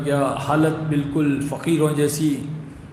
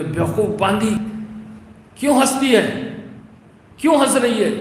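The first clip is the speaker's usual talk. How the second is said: angry shout; 6 semitones higher than usual. Retold or imitated. King furious at the laughing slave girl, shouting. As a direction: snap at her in disbelief. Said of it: "بادشاہ سلامت کو غصہ آ گیا".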